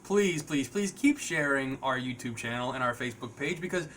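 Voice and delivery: ordinary talk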